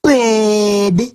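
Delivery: mock a too-cool waiter cutting words short; trendy slacker imitation. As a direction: stretch one clipped slang word lazily. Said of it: "That is the voice of where in the new trendiness".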